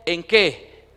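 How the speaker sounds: loud, fervent pulpit delivery; full-force preaching. Acted. Pastor preaching loudly to his congregation, fervent. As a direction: preach it out to the whole church.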